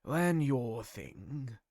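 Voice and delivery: usual speaking voice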